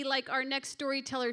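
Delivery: ordinary talk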